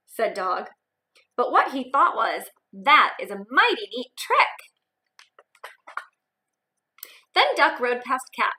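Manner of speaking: put-on lively read-aloud for young kids; expressive picture-book narration; storybook reading.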